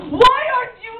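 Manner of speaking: high, wailing prophet bunny; doom-saying bunny. Acted. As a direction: proclaim it high and drawn out. Bunny proclaims doom in story.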